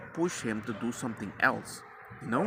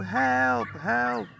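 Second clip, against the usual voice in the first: high-pitched screamed help; imitated screaming; 10 semitones higher than usual. Father retells screaming for help while wife is on phone, screaming. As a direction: call out high for rescue. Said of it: "We were screaming".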